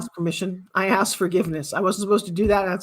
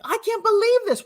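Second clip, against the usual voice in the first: mock disbelief; 12 semitones higher than usual. Imitating people's disbelief.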